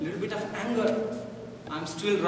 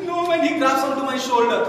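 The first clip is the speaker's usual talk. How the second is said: ordinary delivery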